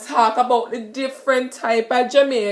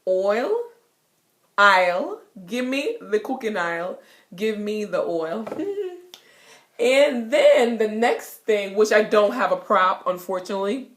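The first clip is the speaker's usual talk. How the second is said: ordinary delivery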